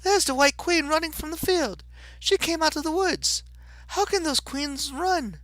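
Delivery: high-pitched, amazed Alice voice; excited cry. A narrator's reading. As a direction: exclaim it like an astonished little girl. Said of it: "she cried".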